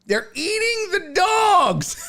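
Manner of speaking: hammed-up Trump rally shout; Trump quote. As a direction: belt it like the viral Trump clip. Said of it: "that song was so good".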